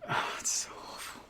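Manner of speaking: soft, whispered lament; exasperated whisper. Real, not acted. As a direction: sigh it out under your breath, almost voiceless. Groaning about the puns.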